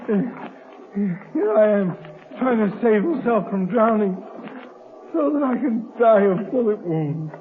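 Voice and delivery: ordinary talk, nothing marked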